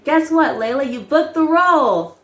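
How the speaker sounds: excited good news. Retold or imitated. Agent calling with news of the role, imitated excitement. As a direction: build to a big reveal.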